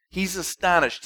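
ordinary talk, nothing marked

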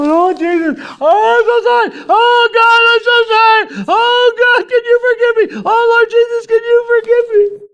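very high, loud wailing plea; desperate begging. Acted. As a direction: cry out for forgiveness at the top of your voice. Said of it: "I just started begging God".

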